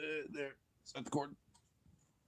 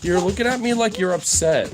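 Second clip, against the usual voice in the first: higher, louder talk to a dog; playful pet talk; 6 semitones higher, 5 dB louder than usual. Real, not acted. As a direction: tease the dog in a raised voice.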